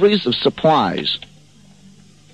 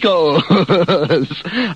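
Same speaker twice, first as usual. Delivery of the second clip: laughing; 3 semitones higher than usual. Real, not acted. Reading Spanish box, laughing.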